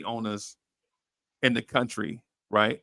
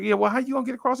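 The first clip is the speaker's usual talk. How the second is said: much higher, incredulous question; pitched-up retold disbelief; mock disbelief; 12 semitones higher, 3 dB louder, much faster than usual. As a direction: ask it like you can't believe it. His own retold question.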